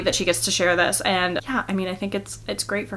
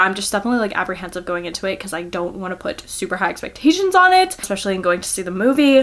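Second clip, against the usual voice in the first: ordinary talk, nothing marked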